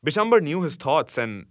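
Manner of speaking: usual speaking voice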